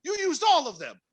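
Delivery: high-pitched retold tirade; berating the cook in the retelling; high exasperated scolding.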